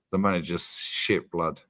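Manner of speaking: flat dry monotone delivery; deadpan monotone imitation of dad; dad's monotone. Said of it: "with his monotone voice".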